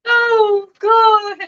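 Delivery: high, breathless with laughter; real laughter cracking the words; laughing. Real, not acted. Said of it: "I can't breathe for laughing".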